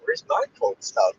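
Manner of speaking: ordinary delivery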